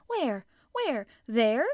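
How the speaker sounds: high, loud, urgent questions; excited cry. A narrator's reading. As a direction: fire out each eager question loudly. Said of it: "he cried".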